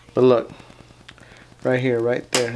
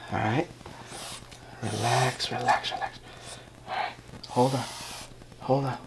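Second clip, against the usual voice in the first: soft soothing; 10 dB quieter than usual. Real, not acted. Soothing a struggling pet.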